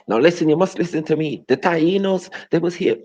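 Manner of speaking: high, loud mock insistence; mock lecturer. Mocking a know-it-all about the Tainos. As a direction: imitate someone insisting on history.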